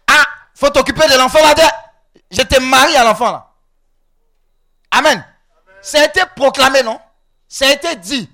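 loud, forceful pulpit declarations; preacher at full volume; full-force preaching. Real, not acted. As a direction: proclaim it with authority.

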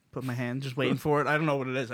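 ordinary talk

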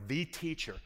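softly stressed "the", said as "thee"; emphatic THE. Shown as a demonstration. Preacher explains the definite article, emphatic on "the". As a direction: put the weight on "the", not "teacher". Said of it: "it's emphatic. It's a definite article".

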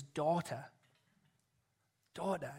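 soft, tender address with a long pause; loving and tender. Acted. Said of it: "He speaks to her lovingly and tenderly".

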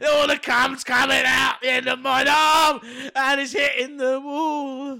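mock shout. Topping the cohost's rhyme with a loud mock cry. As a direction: shout it like a ridiculous climax.

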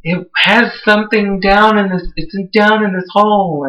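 a touch of spooky clairvoyant; lightly voiced old clairvoyant. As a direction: mimic a creepy old psychic, lightly. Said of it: "she's like".

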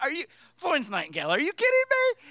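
loud mock outrage rising high; hammed-up yell, shooting up in pitch; incredulous outburst. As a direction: explode at the nurse in disbelief. Incredulous outburst at nurse's plan, retold.

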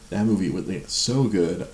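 ordinary delivery

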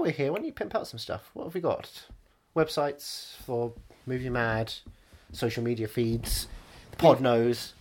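low, dreamy voice; low character voice. Acted. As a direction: sigh it like smitten. Low host voice.

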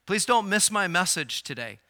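normal talking voice

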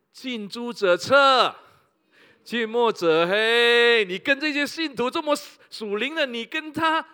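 proverb hammered out slowly and loudly; slow, weighty pulpit proverb; emphatic preaching. Acted. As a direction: drive the saying home word by word.

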